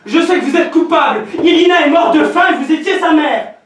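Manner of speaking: accusation criée. Acted. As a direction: accuse plus fort. Fils accuse la mère. (fils qui accuse sa mère en criant)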